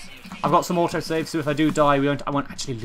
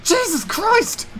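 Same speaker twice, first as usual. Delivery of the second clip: high, loud panicked shock; shocked yelling; 11 semitones higher, 11 dB louder, slower than usual. Real, not acted. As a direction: scream out in disbelief. Four-star enemy appears.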